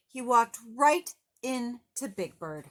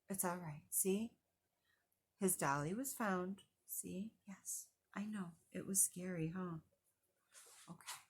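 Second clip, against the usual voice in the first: much softer, hushed comfort; soothing aside; 2 semitones lower, 12 dB quieter, faster than usual. Real, not acted. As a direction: murmur it to a little one on your lap. Reader soothing child mid-story.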